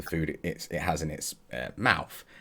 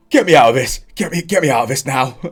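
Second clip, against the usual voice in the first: loud, frantic yell to be freed; panicked shout; 7 semitones higher, 12 dB louder, faster than usual. Acted. Freed dwarf yells at the party in panic. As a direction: scream to be let out.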